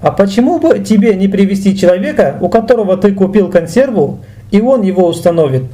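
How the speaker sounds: usual speaking voice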